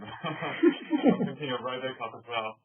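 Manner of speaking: laughing through the words, slowed. Real, not acted. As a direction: giggle while correcting yourself. Laughs at own slip.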